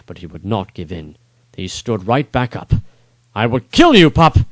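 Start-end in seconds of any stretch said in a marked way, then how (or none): 3.3-4.4 higher, louder bellowed threat; enraged lord threatening the young knight; menacing threat. Acted. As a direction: growl it like a mad brute.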